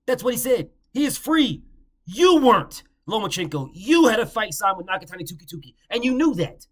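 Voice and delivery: loud and high, fired up; heated accusation. Real, not acted. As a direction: jab each line with anger.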